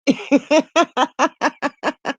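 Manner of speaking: rapid, high cackle; real rolling, crazy laughter. Real, not acted. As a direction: cackle along freely. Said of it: "We can laugh crazy together".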